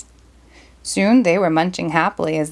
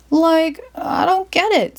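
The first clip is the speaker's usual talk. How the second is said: Shaggy puzzled; 8 semitones higher, slower than usual. Acted. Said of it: "said Shaggy".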